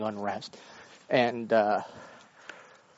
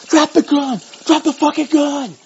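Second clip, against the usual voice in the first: high, loud barked command; imitated police shout; 11 semitones higher, 11 dB louder than usual. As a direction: bark it like a cop at gunpoint. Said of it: "cops in the background yelling".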